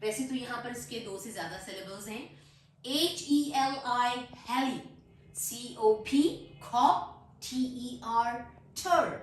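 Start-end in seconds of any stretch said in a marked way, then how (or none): 2.8-9.1 slow spelling, then each syllable on its own. Shown as a demonstration. Teacher dividing a long word, syllable by syllable. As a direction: spell and sound out heli, cop, ter separately. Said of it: "syllables में divide".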